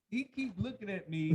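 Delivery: high, loud, laughing complaint. Real, not acted. Guest cracking up at the one staring, laughing. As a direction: squeal it through real laughter.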